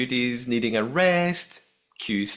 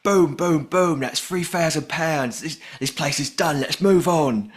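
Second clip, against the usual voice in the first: loud London geezer impression; brash, raised London patter; London accent; 5 semitones higher, 4 dB louder than usual. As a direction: boom it out in a put-on London accent. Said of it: "is from somewhere in London".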